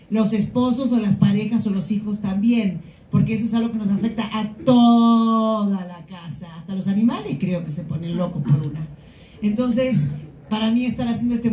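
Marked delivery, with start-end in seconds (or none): 4.3-6.5 "toda" held very long and loud; stretched emphasis on TODA. Real, not acted. Speaker explains who menopause affects, drawn-out emphasis on "toda". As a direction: lean hard on "toda" and draw it out.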